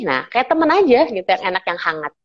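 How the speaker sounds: normal talking voice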